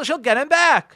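usual speaking voice

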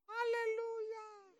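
long, very high drawn-out praise cry; high-pitched hallelujah. Real, not acted. Preacher praising before congregation, high drawn-out exclamation. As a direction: stretch the praise out high and soft.

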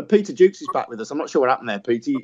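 usual speaking voice

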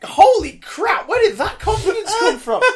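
high, slow, astonished exclamation; stunned laughing wonder; amazed disbelief. Retold or imitated. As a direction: gasp at the kid's sudden confidence. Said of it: "And I'm just like".

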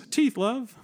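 high-pitched mock British accent; Tracer. Friend mimicking the British dental assistant, high British accent. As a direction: squeak out a jokey British impression. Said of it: "had, like, this British accent".

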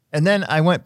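ordinary delivery

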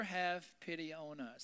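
ordinary talk

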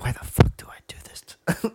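whispered exasperation, voiceless; imagined inner grumble. Retold or imitated. Voicing a guy's thoughts while fixing the bed, exasperated whisper. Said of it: "in the back of his head".

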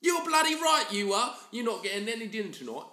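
high, loud mock scolding from a Cockney mum; hammed-up telling-off of the son. Cockney mum telling her son he gets no dinner, loud and high.

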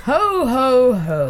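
big put-on Father Christmas laugh; departing Santa. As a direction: boom a farewell ho ho ho. Santa leaves up the chimney.

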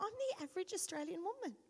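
fast, a touch high, savouring it; repeating it, pleased. Retold or imitated. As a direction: try the phrase on happily. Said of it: "I said it a few times".

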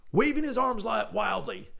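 high, excited storyteller outburst; frantic narration. A narrator's reading. Narrator describing a visitor's flailing entrance, in frantic excitement. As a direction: let the narration go wild and high.

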